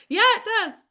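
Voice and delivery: small high-pitched polite reply; mock-timid agreement; shy stranger. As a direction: answer shyly, pitched well up. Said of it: "Like, they're shy".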